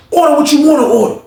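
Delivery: high, loud showy offer; flaunting. Retold or imitated. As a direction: act out splashing money around. Alvin flaunts his money.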